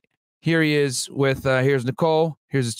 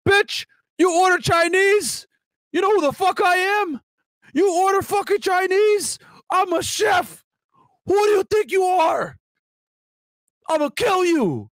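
much higher, hammed-up furious chef; mock enraged Jason. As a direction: play the insulted son, yelling over takeout. Voicing Jason's rage over Chinese food.

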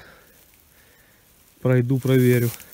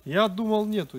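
usual speaking voice

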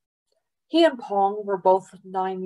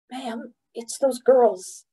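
lightly voiced child, higher and slow; a higher, drawn-out appeal to an adult; child pleading to guard; 5 semitones higher, slower than usual. A narrator's reading. Pong reports the girls to the guard, lightly voiced pleading child.

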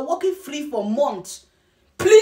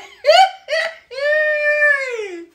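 very high, squealing put-on cackle; staccato laugh into a long falling squeal; mock cackle; 12 semitones higher, 4 dB louder than usual. Capping a mock water warning, mocking high cackle.

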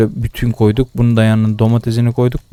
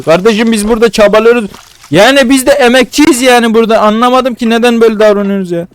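higher, louder, fed-up complaining; exasperated outburst; 8 semitones higher, 4 dB louder than usual. Real, not acted. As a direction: vent at the customers who won't rate you. Beğenmeyen müşterilere kızıyor. (müşterilere sitem eden, bağıran kızgın ses)

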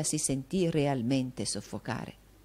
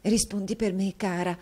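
plain reading voice

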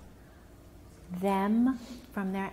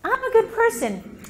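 put-on higher child voice, lightly done; a hint of a hopeful child; child self-affirming; 12 semitones higher, 7 dB louder than usual. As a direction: let the kid believe it. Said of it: "help the child go".

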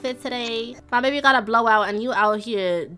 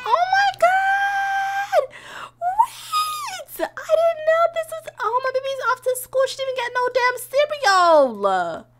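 high, loud cry of shock; 3 dB louder, slower than usual. Real, not acted. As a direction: shriek in dismay at the news. Baby left for school unfed.

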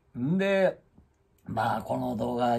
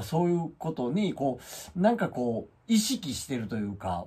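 normal talking voice

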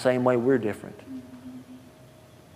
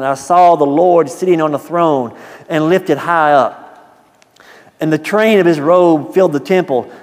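louder scripture read from the pulpit; preaching reading; 4 semitones higher, 8 dB louder than usual. Acted. Preacher reading Isaiah to the congregation, louder. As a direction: proclaim the verses to the church.

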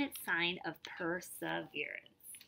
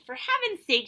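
impatient, higher exasperated sigh of words; complaining; 9 semitones higher, 7 dB louder than usual. Acted. Trudy impatient with the long greasing, complaining. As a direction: act fed up with the wait. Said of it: "she complained".

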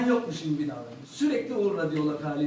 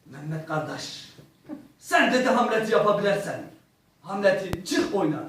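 a hint of an Azeri acting teacher; Azeri accent. Azeri acting teacher urging him to play Hamlet, mild Azeri accent. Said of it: "böyle bir şiveli".

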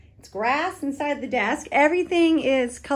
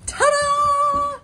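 high, bright reveal shout; excited ta-da; 14 semitones higher than usual. Real, not acted. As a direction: present it like a magic trick. Revealing the hallway.

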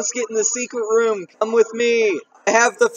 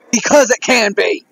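louder, deliberately slow; loud on purpose; 6 dB louder than usual. Real, not acted. Answering why it's loud, a deliberately booming reply. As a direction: boom it out just to be loud. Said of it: "I just wanna be loud".

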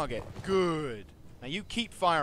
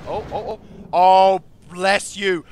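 loud, delighted cry; delighted shout; 4 semitones higher, 16 dB louder, slower than usual. Real, not acted. Gamer thanking his archers, loud and delighted. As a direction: bless them at full volume.

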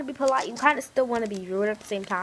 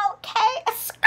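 high-pitched sassy catchphrase; squealed comeback; 12 semitones higher than usual. Acted. Comeback catchphrase to angry kid.

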